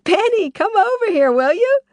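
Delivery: high, loud calling scream across a noisy bar; woman screaming for him from across the bar. A narrator's reading. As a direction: yell for Benny over the crowd. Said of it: "screamed the woman".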